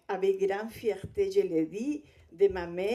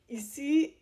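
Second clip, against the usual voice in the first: normal talking voice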